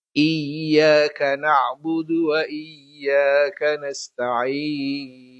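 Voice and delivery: slow, measured chant with long held endings; Qur'an recitation. Shown as a demonstration. Teacher reciting Al-Fatihah for the lesson, slow melodic recitation. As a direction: recite verse by verse, stretching the final vowels.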